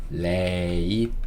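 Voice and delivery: "late," stretched out slowly, rising at the end; syllable-by-syllable demo. Shown as a demonstration. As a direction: draw out the vowel of "late," for learners. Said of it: "L은 한 번 더 일하니까 요렇게". (음절을 길게 늘인 발음 시범)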